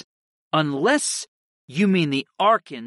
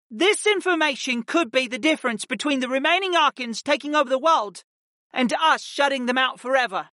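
higher, firm commanding woman; Ashla the Ice Mage; 10 semitones higher than usual. A narrator's reading. Ice Mage ordering the aunt to give up the book, stern. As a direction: lay down the law, steady and stern.

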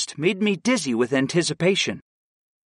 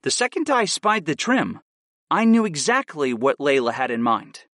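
plain reading voice

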